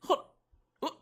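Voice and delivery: ordinary talk, nothing marked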